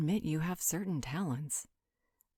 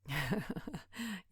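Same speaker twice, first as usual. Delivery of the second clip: short, soft chuckle; 3 semitones higher, 3 dB quieter than usual. Acted. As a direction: let out a light little laugh. Amused reaction to the compliment.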